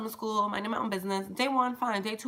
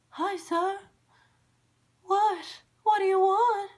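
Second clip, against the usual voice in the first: put-on much higher, timid little kid voice; scared young self; 12 semitones higher, slower than usual. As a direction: squeak it out like a frightened twelve-year-old. Scared kid answering the stranger.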